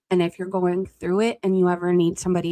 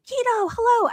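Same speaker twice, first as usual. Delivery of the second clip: high greeting. Real, not acted. Greeting a chat member by name.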